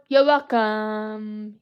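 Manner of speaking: Maui catchphrase. Pretend. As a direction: drag it out like the cocky demigod.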